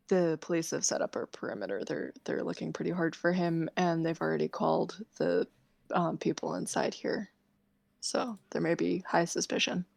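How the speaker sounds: softly confiding warning; low, discreet. Acted. Serafina warning Basalt out of the children's hearing, hushed. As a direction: keep it down so children can't overhear. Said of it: "just says low, like, so that the little ones don't hear".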